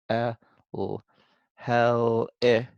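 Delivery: "Eh", "l" alone, then blended into "hel", "eh"; sounding out. Shown as a demonstration. Teacher writes sounds of a syllable, sounding out. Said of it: "there's three sounds".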